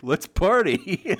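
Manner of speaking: slow, laughing imitation of partygoers; drawn-out let's party. Mocking Japanese partygoers, slow and laughing. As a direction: stretch it out slowly with a laugh. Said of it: "they're just like".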